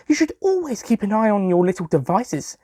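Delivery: put-on teasing girl voice. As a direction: wag a finger at him in a girl's voice. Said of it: "Susie said".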